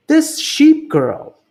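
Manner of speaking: high, slow wondering voice; puzzled thief. Acted. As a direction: peer at the picture, puzzled. Luther staring at painting.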